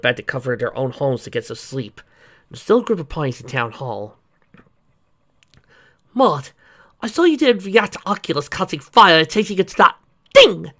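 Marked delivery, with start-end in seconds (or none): 6.1-10.7 high, loud eager exclaim. Acted. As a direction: blurt it out excitedly. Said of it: "Randy exclaimed".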